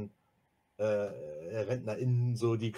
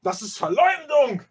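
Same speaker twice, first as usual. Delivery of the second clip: high, loud indignant exclamation; scandalized outburst; outraged cry; 12 semitones higher, 9 dB louder than usual. Acted. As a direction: shout it as a grave accusation. Erasmus cries slander.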